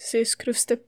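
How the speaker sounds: plain reading voice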